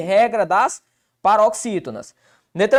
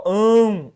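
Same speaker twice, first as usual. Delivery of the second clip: "Ão" said alone, held and forceful; stressed syllable isolated. Shown as a demonstration. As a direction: pull out "Ão" as the strong syllable. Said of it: "Tá aqui, ó". ("Ão" isolado, forte e alongado)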